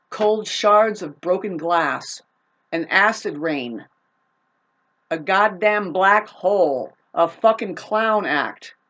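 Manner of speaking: slow, each insult spat out; slow contemptuous string of insults; slow scornful list. Real, not acted. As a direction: drag out every insult with contempt. Listing insults slowly.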